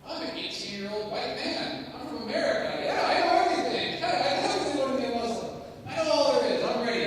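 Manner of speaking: loud, fast, know-it-all brag; cocky self-mockery. Young soldier mocking his own eager certainty, loud cocky brag. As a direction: ham up the clueless young hotshot, loud.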